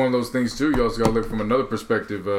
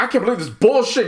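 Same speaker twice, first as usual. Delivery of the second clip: indignant outburst; 11 semitones higher, 4 dB louder than usual. Retold or imitated. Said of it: "Busby was like".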